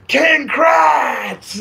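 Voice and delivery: loud high shout of the answer; boisterous shout. Retold or imitated. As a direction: blurt the name out loud, full of glee. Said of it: "Paul said it so, like, boisterously, loudly".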